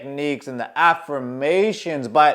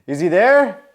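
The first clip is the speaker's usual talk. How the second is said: ordinary talk, nothing marked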